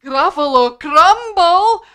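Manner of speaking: loud, high, slow horrified wail; scared Gruffalo. Acted. Gruffalo hearing the mouse's threat, horrified wail. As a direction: draw it out in hammed-up horror. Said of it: "Let's all look a bit scared".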